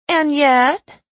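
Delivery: high, loud Southern belle; Southern belle accent. A narrator's reading. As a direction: channel Scarlett in a showy Southern accent. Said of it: "still channeling her inner Scarlet".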